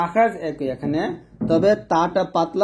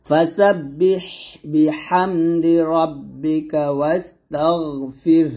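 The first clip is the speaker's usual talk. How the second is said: slow recitation; much slower than usual. Shown as a demonstration. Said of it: "উদাহরণ চাই".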